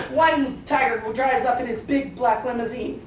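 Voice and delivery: ordinary delivery, nothing marked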